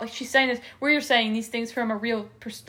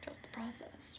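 whispered echo; 19 dB quieter than usual. Real, not acted. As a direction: whisper the phrase back, much softer. Friend echoes her closing line.

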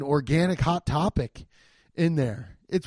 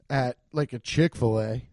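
ordinary talk

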